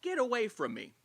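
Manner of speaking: toned-down imitation of her screaming; mild mock scream. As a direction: quote a scream without really screaming. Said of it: "while screaming".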